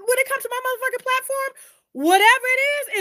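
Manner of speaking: ordinary delivery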